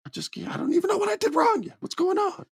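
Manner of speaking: very high, fast, panicked pleading; frantic squeal. Retold or imitated. Sami panicking to Roman.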